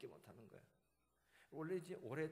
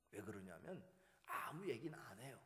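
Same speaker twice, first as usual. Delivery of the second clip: usual speaking voice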